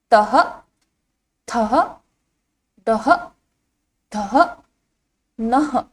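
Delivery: "तह, थह, दह, धह, नह" each apart with an h ending; visarga pronunciation. Shown as a demonstration. Teacher reciting the row for children, visarga pronunciation. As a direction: sound out "तह, थह, दह" and on, pausing after each. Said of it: "इसका उच्चारण किस तरह से किया जाता है".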